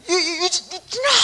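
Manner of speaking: high, loud stuttered cry of a name; stammering shocked shout. Acted. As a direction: choke on the name, then shriek it. Catches son drinking the milk.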